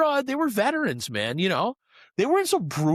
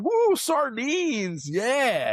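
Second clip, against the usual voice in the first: high, drawn-out whoop; hammed-up crowd delight, pitched way up; mock cheering fans; 10 semitones higher, much slower than usual. Co-host joins in mimicking kids at the show, mock cheering. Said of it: "Yeah, they're like".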